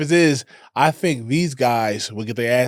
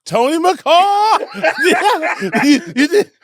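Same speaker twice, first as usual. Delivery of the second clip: high-pitched laughing through the words; cracking up while talking; 5 dB louder, much slower than usual. Real, not acted. Laughs at friend's new nickname.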